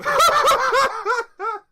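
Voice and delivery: very high, loud burst of laughter. Real, not acted. Laughing at her Mulan Rouge joke. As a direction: cackle at own joke.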